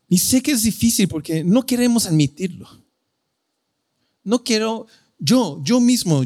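impassioned sermon peak; fervent preaching. Acted. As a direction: press the confession home with rising passion. Preacher builds to fervent climax.

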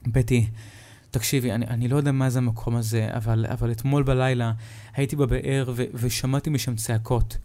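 usual speaking voice

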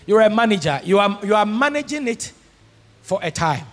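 ordinary talk

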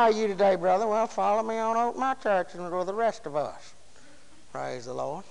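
low, soft, droopy drone; mock a dreary churchgoer with no life in him; lifeless deadhead. Deadhead inviting a brother to church, lifeless and low. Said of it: "Ain't nobody gonna follow no deadhead".

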